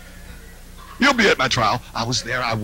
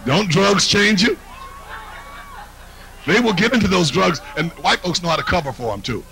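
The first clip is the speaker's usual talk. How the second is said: usual speaking voice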